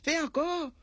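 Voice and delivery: high excited shouting at the others. Acted. The little lady yells to the men to keep fighting. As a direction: egg the men on in a high cry. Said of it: "crie, eh".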